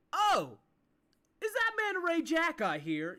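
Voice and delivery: loud, high, surprised greeting; delighted king's call. Acted. King Amathar recognizing an old friend, loud delighted call. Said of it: "you hear Amathar says".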